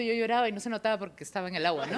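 ordinary delivery

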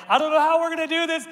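high, fast, strained cry of despair; desperate cry. Retold or imitated. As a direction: cry it out like you're at the end of your rope. Retold crying out to God.

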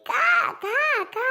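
put-on high playful caw caw for the baby; crow cawing imitation. Mother cawing to her baby, high playful crow call. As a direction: caw like a crow to amuse the child.

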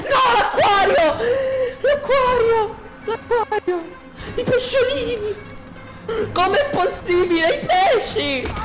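high, wailing cries of mock grief; mock despair. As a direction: wail over the fish dramatically. Aquarium smashed in fight.

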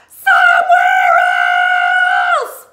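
shouting. Acted. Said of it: "He was shouting".

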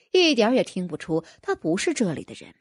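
usual speaking voice

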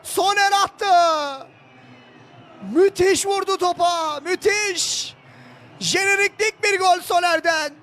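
high, loud, drawn-out goal scream; screaming football commentator. Real, not acted. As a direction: stretch every word of the goal call. Second goal call.

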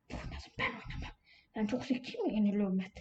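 whispered, barely audible grumble; whispered aside. Real, not acted. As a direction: mutter it under your breath to yourself. Murmelt genervt zu sich selbst.

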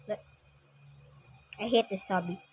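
normal talking voice

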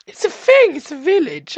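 high, loud burst of excitement; excited exclaiming. Real, not acted. Player exclaiming that it's a village, high and excited. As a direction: burst out with it, voice jumping up.

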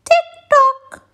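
clock ticking. Acted. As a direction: tick like a clock. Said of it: "went the living room clock".

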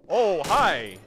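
very loud, high startled greeting; real shock, blurted at full volume; startled shout. Real, not acted. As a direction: yelp it like you've been jumped. Startled by enemy in game.

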